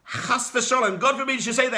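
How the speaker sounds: outraged protest. Acted. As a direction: rise up against the insult.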